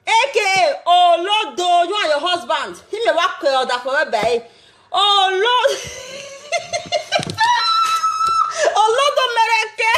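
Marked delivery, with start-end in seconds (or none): 4.9-9.9 very high shrieking laugh breaking into the word; cracking up. Real, not acted. Mocking Monica's nickname, cracking up. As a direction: lose it in shrill laughter.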